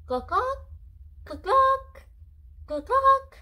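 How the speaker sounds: high loud clucking; chicken cluck imitation. Shown as a demonstration. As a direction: cluck like a hen. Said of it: "What does the chicken say?".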